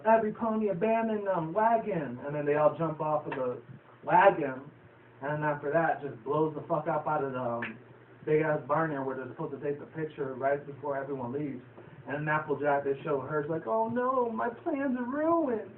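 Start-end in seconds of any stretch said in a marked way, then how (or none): 0.1-2.2 high-pitched mock yell; imitating Apple Bloom's yell. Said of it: "and then Apple Bloom yells". 13.7-15.7 much higher, slow, hammed-up wail; a cartoon pony bemoaning her ruined plans; mock despair. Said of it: "she starts crying".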